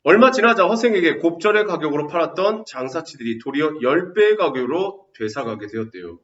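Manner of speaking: loud, bright reading of the passage; textbook reading. Acted. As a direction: read the story out to the class. Reads textbook passage aloud. (교과서 낭독하듯 크게)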